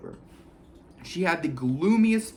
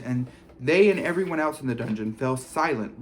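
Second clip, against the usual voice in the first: ordinary delivery, nothing marked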